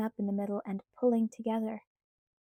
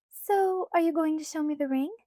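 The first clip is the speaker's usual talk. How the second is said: put-on light, higher girlish pleading voice; Alice's voice; 6 semitones higher, 3 dB louder than usual. A narrator's reading. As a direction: voice Alice higher, coaxing Bella. Alice eager.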